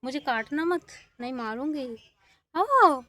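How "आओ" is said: calling a calf. Real, not acted.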